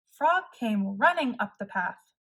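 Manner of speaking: ordinary delivery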